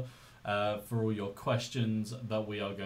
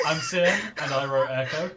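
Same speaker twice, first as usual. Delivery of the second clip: laughing quote; 3 semitones higher, 6 dB louder than usual. Retold or imitated. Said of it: "I was so ready to say".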